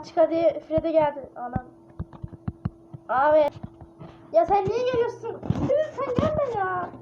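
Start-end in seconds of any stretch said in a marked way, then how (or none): 3.1-6.9 frightened plea to the animatronic; scared. Real, not acted. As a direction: beg the monster to stay away. Freddy jumps at her.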